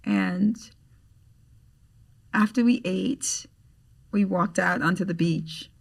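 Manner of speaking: ordinary delivery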